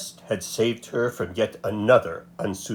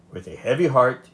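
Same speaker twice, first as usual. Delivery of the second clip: ordinary delivery, nothing marked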